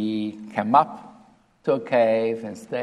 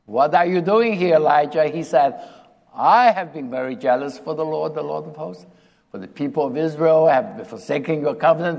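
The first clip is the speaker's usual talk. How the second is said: louder, higher complaint of Elijah; Elijah complaining; 4 semitones higher, 6 dB louder than usual. Retold or imitated. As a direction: whine it out, self-pitying prophet.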